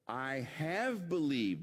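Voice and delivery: slow, drawn-out "have"; stress on HAVE. Acted. Martha answering the Lord, stress on "have". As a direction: stretch and lift "have" as settled conviction. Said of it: "Now it's in the perfect active".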